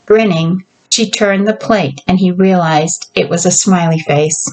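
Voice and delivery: usual speaking voice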